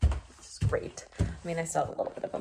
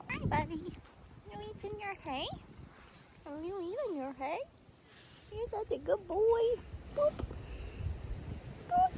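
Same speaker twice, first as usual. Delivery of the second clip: talking to a horse; 11 semitones higher, 7 dB quieter than usual. Real, not acted.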